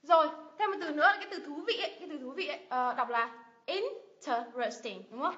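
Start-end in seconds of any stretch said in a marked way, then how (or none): 3.6-5.0 interesting broken into 'in, te, res, ting'; slow syllable-by-syllable interesting; four separate syllables. Shown as a demonstration. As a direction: sound out interesting slowly, beat by beat. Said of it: "Từ này có bốn âm tiết".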